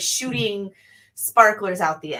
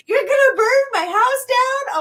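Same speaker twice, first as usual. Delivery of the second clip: whiny neighbor; 7 dB louder than usual. Retold or imitated. Mocking the neighbor who complained about fireworks, high whiny voice. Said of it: "And she's just like".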